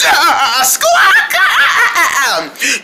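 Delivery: high, loud squawking laugh; laughing squawks. Acted. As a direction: cackle like a parrot.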